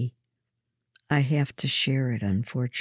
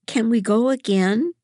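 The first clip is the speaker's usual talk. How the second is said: put-on eager high-pitched child's plea; begging; 8 semitones higher, 5 dB louder than usual. A narrator's reading. Kathleen begs mother to go again, pleading. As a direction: beg brightly, pitch lifted, louder. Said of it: "Kathleen begged".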